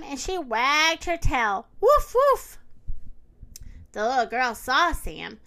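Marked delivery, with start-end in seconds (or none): 1.8-2.6 two high, loud woofs; puppy bark. A narrator's reading. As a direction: bark twice like an excited puppy. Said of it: "said Sam".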